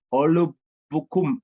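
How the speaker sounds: ordinary talk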